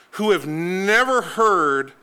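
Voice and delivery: usual speaking voice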